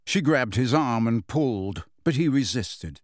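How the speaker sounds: plain reading voice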